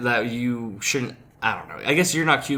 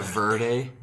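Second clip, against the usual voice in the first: VERDE as "VUR-day", American accent. Shown as a demonstration. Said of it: "embrace my whiteness with verde".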